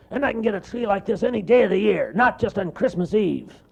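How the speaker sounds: high, loud, fast bear brag; cartoon bear showing off; bragging bear. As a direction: rattle off the boast.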